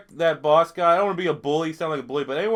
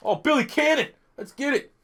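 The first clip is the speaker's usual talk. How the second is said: excited; 9 semitones higher than usual. Real, not acted. Celebrating a player.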